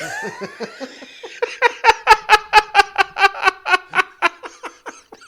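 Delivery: long, high cackling laugh; helpless laughter. Real, not acted. Storyteller cracking up at own story, helpless laughter. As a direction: lose it completely, laughing in bursts.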